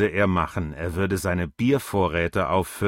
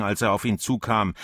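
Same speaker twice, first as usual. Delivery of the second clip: ordinary delivery, nothing marked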